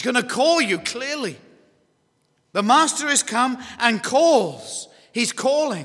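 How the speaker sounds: high, loud, slow proclamation; forceful preaching. Real, not acted. Preacher announcing the Master's call, forceful preaching. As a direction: proclaim it to the whole room, drawing out the key word.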